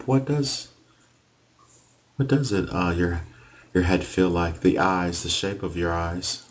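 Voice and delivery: ordinary talk, nothing marked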